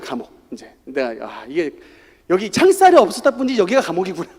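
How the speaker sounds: usual speaking voice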